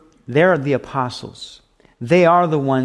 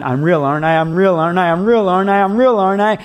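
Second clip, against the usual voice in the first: insistent repeated plea; 5 semitones higher, 5 dB louder, faster than usual. Pretend. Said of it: "he's not always every single day going".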